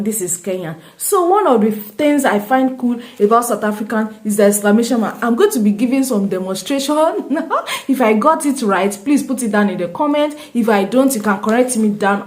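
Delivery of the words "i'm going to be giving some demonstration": ordinary talk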